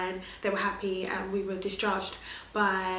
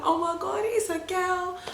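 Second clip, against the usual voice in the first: excited; 10 semitones higher than usual. Retold or imitated. Wife telling husband their baby is a girl, elated. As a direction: tell him it's a girl in joy. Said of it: "I turned around to my husband".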